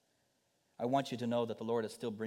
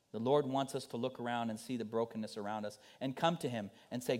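ordinary talk

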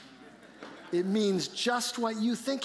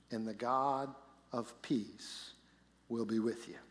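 scripture reading; 5 semitones lower than usual. Acted. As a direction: read the verses with reverence. Reads the scripture passage aloud.

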